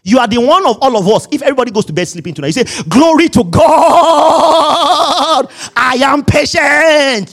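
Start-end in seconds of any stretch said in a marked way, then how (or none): 2.9-7.3 high, drawn-out triumphant proclamation; exaggerated praise cry, loud and slow; jubilant shout. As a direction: belt it out in mock triumph, stretching the last words. Acted triumphant self-declaration.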